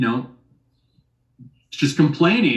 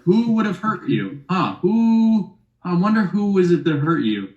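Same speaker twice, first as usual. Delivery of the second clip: mock sarcastic questioning; 5 semitones higher than usual. Mocking the blaming woman.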